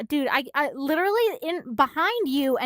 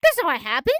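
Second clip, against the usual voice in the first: high, louder startled questioning; retold shock, pitch jumping up; worried disbelief; 4 semitones higher, 6 dB louder than usual. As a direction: react as if alarmed by what you read. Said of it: "I worried. I'm like".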